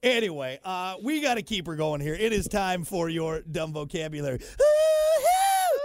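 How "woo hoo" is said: high loud whoop; whooping cheer. Acted. Host whooping to kick off the segment, loud whoop. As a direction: whoop like a stadium crowd.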